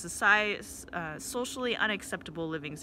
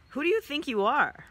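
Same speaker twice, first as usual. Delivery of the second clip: mock indignation; 6 semitones higher, 5 dB louder than usual. Woman taunting stranger, retold.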